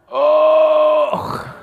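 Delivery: a high, loud cry of pain; high, loud birth-pang cry from a preacher; labour cry. Acted. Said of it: "Wat roept een vrouw als ze in barensweeën is?".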